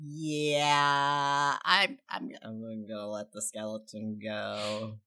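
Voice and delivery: hushed, hesitant answer; very soft, halting and reluctant; subdued reluctance. Acted. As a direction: trail away softly, unsure and giving in. Shaken Silas answers in character.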